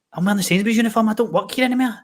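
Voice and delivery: sudden high-pitched alarm; raging realisation. Retold or imitated. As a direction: voice jumping way up as it dawns on him. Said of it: "And I'm raging".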